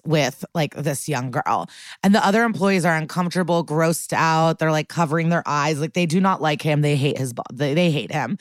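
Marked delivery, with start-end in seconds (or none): none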